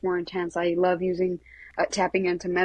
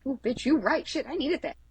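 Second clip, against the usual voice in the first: high-pitched mock reaction, climving higher; humbled agreement; 8 semitones higher than usual. Student conceding to Reiki mentor after being told off, high humbled agreement. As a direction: act out taking a scolding gratefully, pitch climbing.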